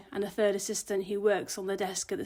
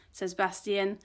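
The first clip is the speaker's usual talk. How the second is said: usual speaking voice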